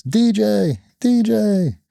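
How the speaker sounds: put-on high little-kid call of D J; a small daughter calling for her dad across the room, high and sing-song; child calling DJ. His little daughter calling him.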